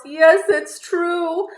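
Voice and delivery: high, loud, slow wail; a fake bunny confessing the truth in tears. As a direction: wail it out, heartbroken. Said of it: "He wails".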